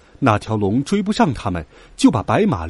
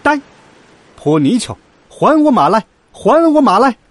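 shouted taunt climbing high; loud, high, demanding yell; loud challenge; 9 semitones higher, 7 dB louder than usual. A narrator's reading. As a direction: call the enemy out, building to a high shout. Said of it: "来到水面上高叫道".

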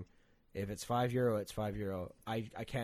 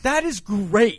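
much higher and louder, grinning gush; beaming delight; 10 semitones higher, 16 dB louder, much slower than usual. Retold or imitated. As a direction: gush it out through a massive smile. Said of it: "I had it with a massive smile".

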